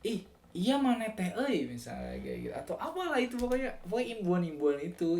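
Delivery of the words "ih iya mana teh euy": high Sundanese lilt with tag words; Sundanese accent. Shown as a demonstration. Example of Sundanese particles for viewers, high lilting Sundanese accent. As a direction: play a Sundanese speaker, pitch swinging high. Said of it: "itu ciri khas banget".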